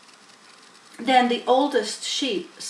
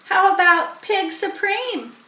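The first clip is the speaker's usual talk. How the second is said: lamb voice; 11 semitones higher, 7 dB louder than usual. A narrator's reading. Lamb voiced by story reader.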